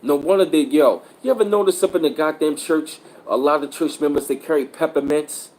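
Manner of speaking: ordinary delivery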